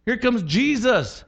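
high, slow startled cry; mock demon panic. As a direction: yelp like a demon seeing Jesus. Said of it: "demons freaked out around him".

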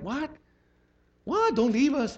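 high, startled cry of the disciples; alarmed disciples. Retold or imitated. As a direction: plead like scared followers. Disciples panicking at Jesus leaving.

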